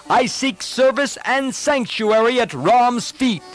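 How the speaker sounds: high, projected proclamation; clear, calm courage. A narrator's reading. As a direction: call out boldly and clearly from above. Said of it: "spoke out in a clear voice".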